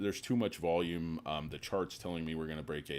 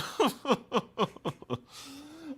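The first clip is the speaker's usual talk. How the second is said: loud, high burst of laughter; 6 dB louder than usual. Real, not acted. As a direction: crack up at your own bit. Laughing at own bit.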